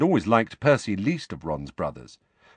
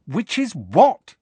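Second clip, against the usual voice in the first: put-on slow, louder demanding boy's voice; Harry wants it straight; impatiently; 12 semitones higher, 8 dB louder, slower than usual. A narrator's reading. Harry presses Hermione, impatient. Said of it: "said Harry impatiently".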